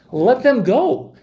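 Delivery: high, loud and slow, every word weighted; emphatic plea. Real, not acted. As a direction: plead it slowly and firmly. Pleading for hostages' release.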